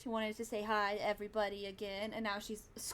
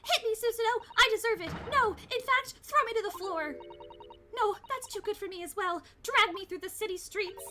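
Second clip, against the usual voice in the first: dramatic self-punishing plea; 10 semitones higher than usual. Acted. Character begging Susato to hit her, overblown cry. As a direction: throw yourself on the floor in melodrama.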